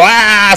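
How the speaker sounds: hammed-up outburst of fury; mock angry yell. Retelling Superman losing his temper, in a mock angry yell. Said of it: "Se encabrona".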